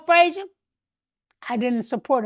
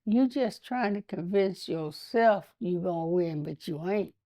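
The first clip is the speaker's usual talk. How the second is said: low tone; 6 semitones lower, 7 dB quieter than usual. Retold or imitated. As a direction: drop to a low, quiet voice. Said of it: "I said it in a low tone".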